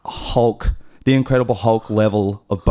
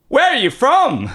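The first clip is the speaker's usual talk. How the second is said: mock yelling; 13 semitones higher than usual. Customer asks barista where he's from, mock yelling. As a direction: imitate a stranger calling at full volume. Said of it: "Like, at that level".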